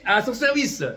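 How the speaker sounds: high, loud rising testimony; fervent preaching. Real, not acted. Preacher telling the church of his fire for God. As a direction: lift it up with fire, like a pulpit proclamation.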